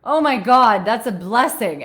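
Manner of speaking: loud, high, gushing; overjoyed to be rid of him; mock delighted relief. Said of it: "And I was like".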